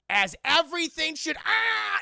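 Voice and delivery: loud, high cry breaking into a yell; hammed-up scream while falling. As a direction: cut off by a sudden fall. She falls through floor.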